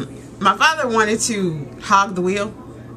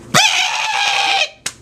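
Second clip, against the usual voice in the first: very high, held beep; imitated car horn. Car honking as dad backs out, high mimicked beep. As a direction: honk it out like a horn.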